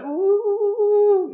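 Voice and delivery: high, loud cartoonish groan; a shrill-pitched mock howl of frustration; whiny wail. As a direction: wail like a cartoon character in pain. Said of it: "he had sort of a high, whiny voice".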